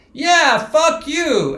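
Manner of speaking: loud, high, drawn-out mock fury; angry outburst. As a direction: act out an indignant yell, slowly and loudly. Acting out Anna's angry outburst.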